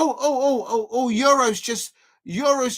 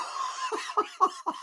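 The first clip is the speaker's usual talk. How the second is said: high incredulous laugh; 11 semitones higher, 8 dB quieter than usual. Real, not acted. After the chaotic save.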